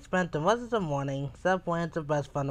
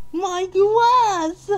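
very high, loud drawn-out wail; horrified cry; 8 dB louder than usual. Acted. Mister Fussy sees his grass squashed, high horrified cry. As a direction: cry out as if mortally wounded. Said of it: "cried Mister Fuzzy".

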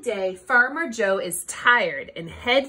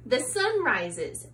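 usual speaking voice

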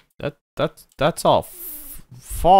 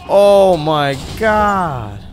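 slow, loud, higher outcry; loud exclamation; 5 semitones higher, 6 dB louder, much slower than usual. Real, not acted. Player reacting to the fight, loud drawn-out exclamation. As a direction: stretch each word out loudly.

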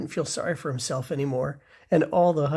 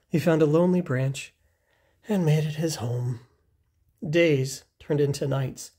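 ordinary delivery, nothing marked

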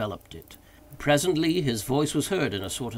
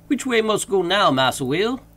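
lightly raised servant voice calling down; a higher, dialect voice from the tree; Jupiter's voice; 7 semitones higher, 7 dB louder than usual. A narrator's reading. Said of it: "he asked".

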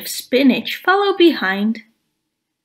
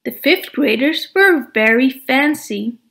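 plain reading voice

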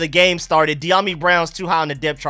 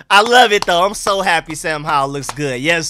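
ordinary talk

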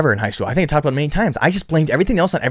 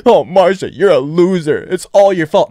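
louder, higher mock scolding voice; inner critic; 5 semitones higher, 5 dB louder than usual. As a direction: berate yourself in a mocking tone. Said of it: "not be like".

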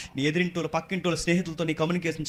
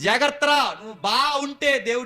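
urgent warning; 8 semitones higher than usual. Acted. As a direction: call out a stern warning, voice raised high. Acts God warning Cain urgently. (గట్టిగా హెచ్చరిస్తూ)

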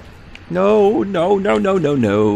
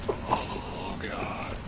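whisper; 2 semitones lower, 14 dB quieter than usual. Real, not acted. Terrified aside.

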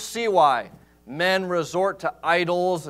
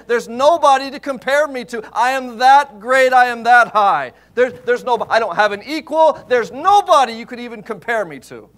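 loud, high, proud declaration; voice of the Lord boasting; 6 semitones higher, 7 dB louder than usual. Retold or imitated. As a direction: boom it out as God who has no equal. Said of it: "It's like Lord saying".

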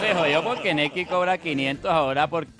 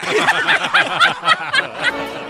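loud squealing burst of laughter; very high laugh; 7 dB louder than usual. Real, not acted. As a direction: shriek with laughter. Boyfriend laughs at joke. (carcajada muy aguda y fuerte)

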